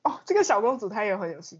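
ordinary delivery

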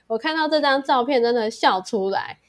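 ordinary talk, nothing marked

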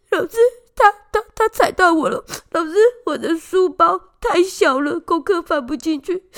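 high, tearful whining child, imitated; mock-sobbing kid complaining to the teacher; crybaby whine. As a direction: play the weepy little boy tattling through tears. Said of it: "他就是那个爱哭的".

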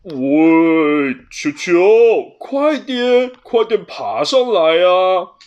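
loud calling down from the treetop; calling with a snicker. A narrator's reading. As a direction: shout down teasingly. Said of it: "一边偷笑一边喊着". (布鲁斯在树顶偷笑着大喊)

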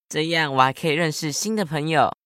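ordinary talk

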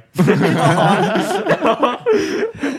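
high loud cackle of laughter; loud burst of laughter. Real, not acted. (放声高声大笑)

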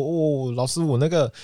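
high-pitched, excited stammering brag; proud boasting. Retold or imitated. Student showing the teacher his hit video, proud and excited. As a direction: gush proudly, tripping over the news. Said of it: "很骄傲的跟他说".

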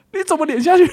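high, startled cry of disbelief, half laughing; retold alarmed question. Brother recalling his shock at his sister's face on the ground, high alarmed cry. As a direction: pitch it way up like you just saw her face hit the road. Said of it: "我说".

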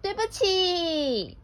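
high, slow, singsong sorry; gleeful. Acted. Bear happily addressing the stung piglet, gleeful. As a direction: draw out a blithely happy apology. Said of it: "很高兴地对小猪说".

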